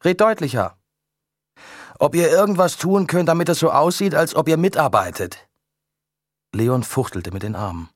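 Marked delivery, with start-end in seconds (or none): 0.0-5.5 raised voice, higher and louder; shouting. A narrator's reading. Photographer shouting instructions across the ice, high and loud. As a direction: call it across a wide distance.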